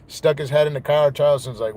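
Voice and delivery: ordinary delivery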